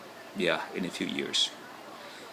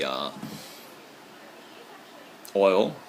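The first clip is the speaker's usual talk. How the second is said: ordinary delivery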